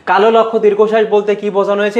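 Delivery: quick reread of the question, stress on লক্ষ্য; hurrying through the question; brisk quiz read. Shown as a demonstration. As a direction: rattle off কালো লক্ষ্য দীর্ঘশ্বাস fast. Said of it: "একটু দ্রুত যাচ্ছি".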